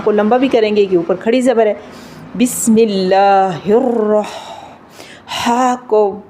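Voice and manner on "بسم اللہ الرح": slow recitation ending on a throat-made ح in "الرح"; tajweed demo of breath-like ح at the end of "الرح"; throaty, thin haa. Shown as a demonstration. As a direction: draw out "بسم اللہ الرح" and release a thin ح from the throat. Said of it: "حلق سے بھی پڑھیں گے اور باریک".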